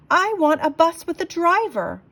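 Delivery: high, loud insistent demand; shouted. Acted. Marcy to the bus passengers, high loud shout. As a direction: shout it up high, still rattled. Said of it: "I shouted".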